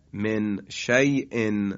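من شیء recited very slowly, the vowel of شیء stretched; drawn-out recitation. Shown as a demonstration. Teacher demonstrates من شیء slowly for students.

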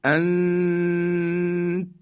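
انت with the n held as a long high hum; slow, steady nasal hold in انت; ikhfa demonstration. Shown as a demonstration. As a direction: hum through the n of انت, then stop on the t.

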